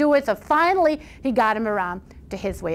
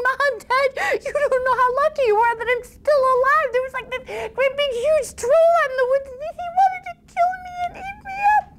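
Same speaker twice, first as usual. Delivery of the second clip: much higher, breathless and frightened; panicked son. Acted. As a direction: blurt it out, terrified. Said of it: "He was sweating and panting".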